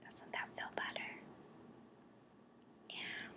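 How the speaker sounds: close, hushed guided-breathing whisper; whispered relaxation. Real, not acted. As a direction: whisper calm reassurance with long pauses.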